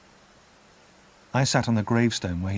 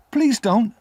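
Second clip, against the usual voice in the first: put-on high, louder child's plea; desperate plea; 12 semitones higher, 6 dB louder than usual. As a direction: beg him, high and loud, please don't. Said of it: "I cried".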